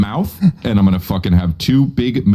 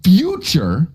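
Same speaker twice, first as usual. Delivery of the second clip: higher, slow, condescending explaining; mock pedantic lecture; 7 semitones higher, slower than usual. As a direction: talk down like a smug teacher.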